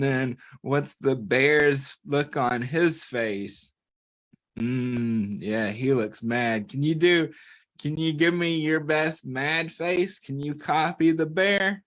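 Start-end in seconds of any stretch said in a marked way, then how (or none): none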